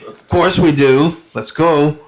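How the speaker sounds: lightly hammed-up readiness to flee; eager agreement in character. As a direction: agree emphatically and get going. Galliford answers the nudge, ready to flee.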